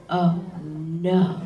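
slow, drawn-out mock dismay; imitated dismayed director. Director reacting to Southern Star Wars characters, imitated dismay. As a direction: let each word sink, imitating his dread. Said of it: "And he goes".

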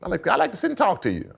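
high put-on executive voice; John Shaw. GM asking the player for a meeting, high mimicked voice. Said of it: "That's how he talked".

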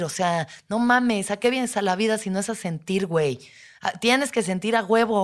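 yelling at herself. Retold or imitated. Standing over herself in therapy, loud harsh scolding. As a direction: berate yourself like a bully. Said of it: "pero gritando cabrón".

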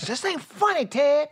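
high, mock scolding mom voice; angry mom. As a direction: scold the chuckling husband like a mom. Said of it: "he was like".

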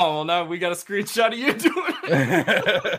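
slow teasing line through laughter; laughing tease. Real, not acted. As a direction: tease him while laughing. Teasing over a screenshot.

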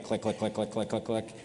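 quiet, muttered click click click; soft clicking sounds. Retold or imitated. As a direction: mimic mouse clicks under your breath. Imitating nerds typing at laptops.

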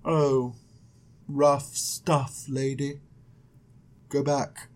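low, soft angel with long pauses. Acted. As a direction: float out sympathy, hushed and unbothered. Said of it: "I need to come up with an angel voice".

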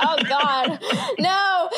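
laughing mock panic. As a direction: laugh through a self-mocking protest. Retells her own comic panic.

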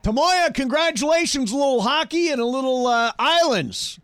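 high, excited game-show host; radio contest promo. Acted. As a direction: pump up the caller like a prize host. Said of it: "I love how excited you get when you say".

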